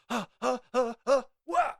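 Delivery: high, panicked gasping into a yelp; freaked-out gasps. Acted. Mayor seeing the Medusa thing, freaking out. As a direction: gasp sharply, then cry out in mock panic. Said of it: "he starts freaking out".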